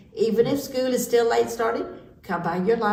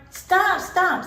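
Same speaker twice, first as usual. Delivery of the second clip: high, loud cut-in; exclaimed protest; 9 semitones higher, 4 dB louder than usual. A narrator's reading. Parrot stopping the quick quackers, exclaiming. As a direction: cut off the racket with a high cry.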